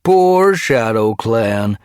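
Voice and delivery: loud, high, drawn-out mock pity; jeering. A narrator's reading. Guard jeering at the trapped Shadow Clan cats, jeering. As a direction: stretch it out and jeer. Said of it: "Gorseclaw jeered".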